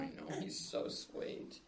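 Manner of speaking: unvoiced, slow whisper. Real, not acted. Whispering while baby feeds.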